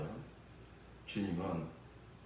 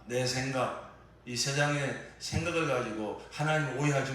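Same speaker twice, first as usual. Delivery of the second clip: louder, higher preaching at full force; fervent preaching; 5 semitones higher, 7 dB louder than usual. Acted. Preacher urging the congregation not to misjudge God, loud and high. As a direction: press the point home from the pulpit.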